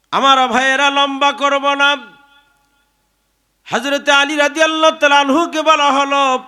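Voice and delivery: high, loud, sustained sermon cry; full-force preaching. Acted. Preacher hails Ali before the congregation, full-force preaching.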